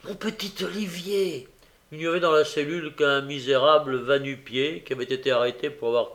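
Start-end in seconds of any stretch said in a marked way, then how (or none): 0.0-1.5 a touch higher, tender calling of a name; mild soft call. A narrator's reading. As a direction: call him gently through the door. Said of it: "murmura-t-elle doucement".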